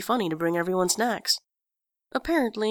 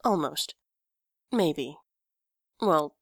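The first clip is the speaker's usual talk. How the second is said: usual speaking voice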